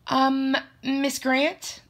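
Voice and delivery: high, hesitant, drawn-out 'um'; nervous blurting. A narrator's reading. Student addresses teacher when called up, nervous hesitation. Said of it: "I blurt out nervously".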